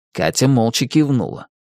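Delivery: plain reading voice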